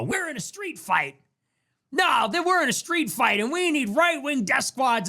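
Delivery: high-pitched mock battle cry; mocking imitation of a tough-talking activist; Jack Posobiec. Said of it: "guys like Jack Posobiec and they go".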